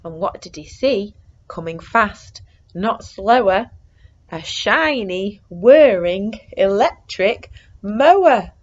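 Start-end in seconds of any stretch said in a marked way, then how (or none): none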